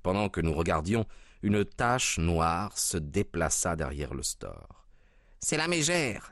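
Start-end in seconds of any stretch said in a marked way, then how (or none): 5.4-6.3 sudden high exclamation; high sharp cry of alarm. A narrator's reading. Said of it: "s'écria Grant Munro". (s'écrier, voix aiguë)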